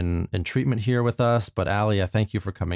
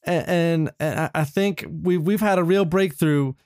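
teary reply; 7 semitones higher than usual. Acted. Tearful reconciliation reply.

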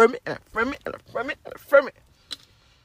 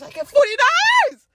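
high, loud thrilled shout; crowing over the exact tip; thrilled cry; 9 dB louder than usual. Real, not acted. Telling listeners about the tip, thrilled shout. Triumphant retelling.

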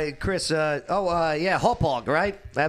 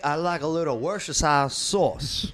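put-on Southern accent. A Southern accent, reading Thessalonians like Bible study. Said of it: "I'll tell you what".

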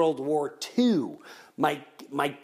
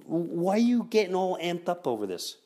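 usual speaking voice

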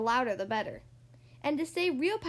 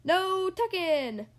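high, slow, drawn-out cry; long-voweled pirate protest; crew cry; 4 semitones higher, 3 dB louder, much slower than usual. A narrator's reading. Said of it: "the crew cried".

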